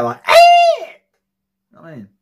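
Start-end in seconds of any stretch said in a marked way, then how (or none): 0.3-0.8 short, very high, loud shriek; imitated scream. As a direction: mimic a jump scare screaming in the ear. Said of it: "screams in your fucking ear".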